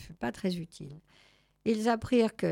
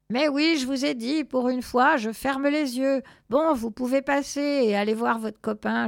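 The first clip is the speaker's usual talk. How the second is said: loud, raised, impatient consent; exasperated chimpanzee gatekeeper; 5 semitones higher, 6 dB louder than usual. Acted. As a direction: wave them through, exasperated.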